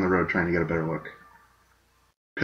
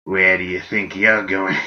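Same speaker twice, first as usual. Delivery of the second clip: goblin voice; 4 dB louder than usual. Acted. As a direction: sneer like a sly goblin. Goblins accost the traveler.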